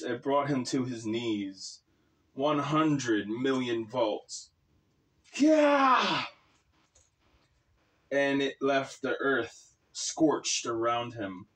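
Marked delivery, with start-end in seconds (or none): 5.3-6.3 high, loud victory yell; triumphant shout. Acted. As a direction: roar it out in triumph. Triumphant shout at lightning strike.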